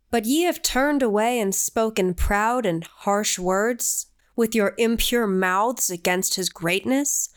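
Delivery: slow, grave biblical recitation; solemn scripture reading. Acted. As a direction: intone it like ancient scripture. Reads scripture aloud.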